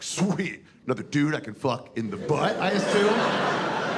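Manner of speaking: eager guy hoping for another dude; excited Adam. Acted. Adam asks God, eager and giggling. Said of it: "he was just like".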